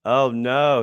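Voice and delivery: usual speaking voice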